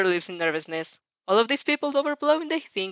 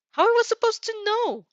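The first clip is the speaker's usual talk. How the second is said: much higher, indignant protest; spouting protest; 3 dB louder than usual. A narrator's reading. Girl protesting to Monoma she couldn't have known, high and indignant. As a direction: fling it back defensively. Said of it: "you spout".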